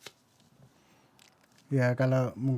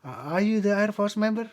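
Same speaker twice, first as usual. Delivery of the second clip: imitated indignant interrogation, pitched up; mock angry questioning; 6 semitones higher than usual. Grave dwellers questioning the newcomer, mock angry. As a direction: question him like an offended resident, lightly acted. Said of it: "Pada marah-marah".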